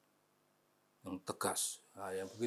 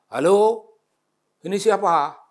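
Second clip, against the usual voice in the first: high, loud phone voice; voiced caller; 9 semitones higher, 10 dB louder than usual. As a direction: answer the phone brightly.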